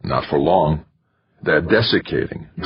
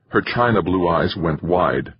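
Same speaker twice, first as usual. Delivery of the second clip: ordinary delivery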